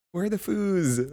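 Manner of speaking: high bouncy mock squeal; excited friend. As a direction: replay her thrilled line. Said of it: "she's so funny".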